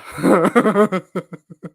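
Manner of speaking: bursting out laughing; big open laugh; loud laugh. Real, not acted. Host cracks up on stream.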